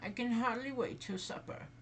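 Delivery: high, lightly voiced old woman.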